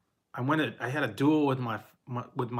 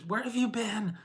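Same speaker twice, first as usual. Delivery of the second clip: worried mom; 9 semitones higher, 3 dB quieter than usual. Retold or imitated. Mother scolding after search.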